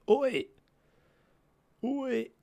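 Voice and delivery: high, loud shout across the park; calling out oi. Real, not acted. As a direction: yell to get their attention. Calling out at the guests.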